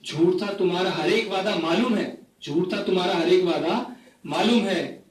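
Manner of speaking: phrase-by-phrase mushaira delivery; poetry recitation. Acted. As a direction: recite each couplet to the audience with pauses. Recites own poem to audience. (मुशायरे के अंदाज़ में कविता पाठ)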